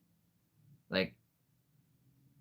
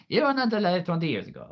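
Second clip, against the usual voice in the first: emphatic; 10 semitones higher, 5 dB louder, faster than usual. Real, not acted. Mock indignant to chat.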